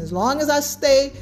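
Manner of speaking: usual speaking voice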